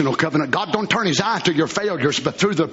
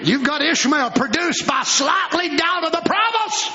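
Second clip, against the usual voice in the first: pulpit shout; 11 semitones higher than usual. Acted. Preaching at full force.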